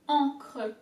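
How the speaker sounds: encre with a guttural French R; throaty French R. Shown as a demonstration. Teacher drilling the French R in encre. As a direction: growl the R in encre like you're upset. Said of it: "the R has to come from deep down, like you're upset".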